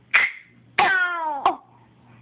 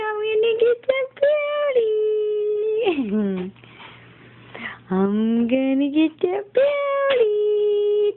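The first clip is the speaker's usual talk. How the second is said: giggly tickle attack; 5 semitones higher than usual. Real, not acted. Playful tickling of baby.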